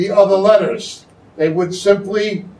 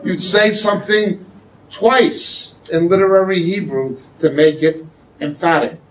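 ordinary talk

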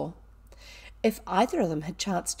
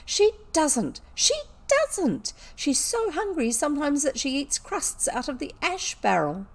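put-on high, upset girl's indignation; acted distressed protest; passionate outburst; 7 semitones higher than usual. A narrator's reading. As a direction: defend your friend, close to sobs. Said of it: "she burst forth".

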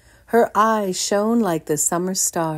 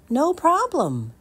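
put-on eager boy; 11 semitones higher than usual. Jameson answers mother.

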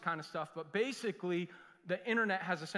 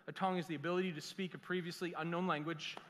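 usual speaking voice

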